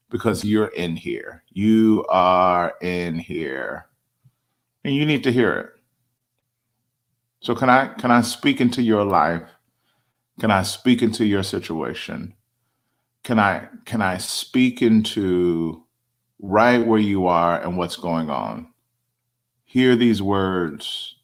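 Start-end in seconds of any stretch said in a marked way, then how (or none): 1.5-3.9 slow emphatic. Acted. Preacher slows for emphasis. 19.6-21.2 slow solemn. Acted. Preacher slows for emphasis.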